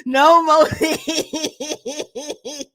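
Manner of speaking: losing it, laughing so hard it cries; this can't be real, wheezing with laughter; crying laughing. Real, not acted. Laughing uncontrollably.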